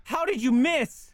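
loud, high and drawn out; incredulous outcry. Real, not acted. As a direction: cry out slowly in disbelief. Exclaims at the missed shot.

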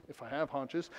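ordinary delivery

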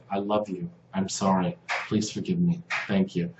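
soft prayer. Shown as a demonstration. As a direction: let it out quietly, letting go. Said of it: "you'll say".

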